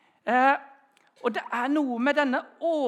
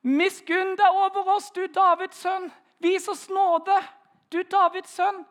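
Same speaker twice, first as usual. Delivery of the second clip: higher, louder, slow pleading call; crying out; 8 semitones higher, 6 dB louder than usual. Retold or imitated. Blind men by the road calling to Jesus, loud and pleading. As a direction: call out across the road for mercy. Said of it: "og roper".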